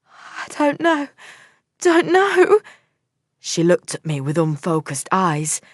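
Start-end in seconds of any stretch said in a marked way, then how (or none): 0.1-2.6 put-on higher girl's voice, numb and shaken; girl in shock recalling her lover's death; dazed girl. A narrator's reading. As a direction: voice the shocked young woman.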